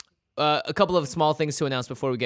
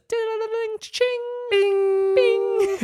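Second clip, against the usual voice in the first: very high ringing mouth noise; cash register noises; 6 dB louder, much slower than usual. Acted. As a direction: squeal a cash register ding. Cash register sound effect.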